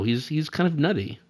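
usual speaking voice

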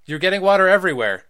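loud, fast scolding. Acted. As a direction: scold the dripping kids. Mom scolding.

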